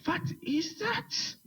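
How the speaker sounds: baffled. Acted. Player stunned by leprechaun.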